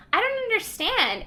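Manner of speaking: put-on high-pitched puzzled child; imitated confused child. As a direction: plead high, like a baffled little kid. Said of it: "Madeline is, like, really confused".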